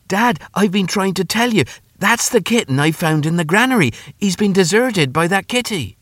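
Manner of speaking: high-pitched earnest son; boy pleading his case. Acted. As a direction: plead with dad in a high boy's voice.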